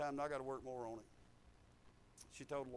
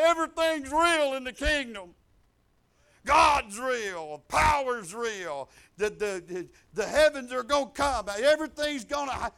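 shouted, much louder and higher; forced confession; 7 semitones higher, 13 dB louder than usual. Acted. Devil on his knees shouting the truth to the congregation.

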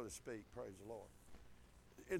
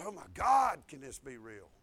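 retold disbelief; 8 semitones lower than usual. Acted stunned thought, dropping to hush.

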